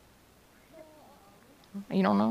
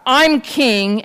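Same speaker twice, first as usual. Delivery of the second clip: raised, higher kingly bark; 6 semitones higher, 7 dB louder than usual. A narrator's reading. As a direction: assert your rank loudly. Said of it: "barked back".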